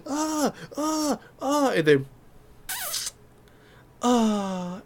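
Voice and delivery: high-pitched mock cries; imitated cries. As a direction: imitate someone crying out from off-screen. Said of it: "all you hear is like".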